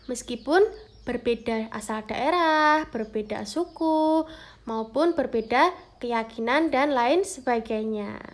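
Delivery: usual speaking voice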